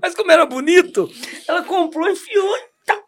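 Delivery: loud, high, laughing through the story; can't stop laughing as he tells it. Real, not acted. Laughing while telling shoe story. (rindo alto enquanto conta)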